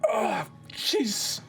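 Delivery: high, bewildered exclamation; groan, then ask in high acted dismay; shaken man. Man wiping blood off after the scuffle, shaken high voice. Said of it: "he's like".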